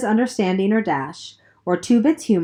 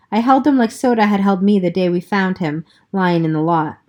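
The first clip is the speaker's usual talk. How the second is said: plain reading voice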